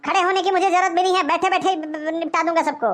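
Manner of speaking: usual speaking voice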